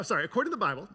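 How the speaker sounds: ordinary talk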